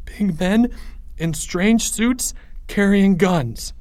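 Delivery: high, slow, halting fear; frightened stammer. A narrator's reading. Leo reporting armed strangers, frightened stammer. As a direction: get it out haltingly, high and scared. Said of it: "stammered Leo".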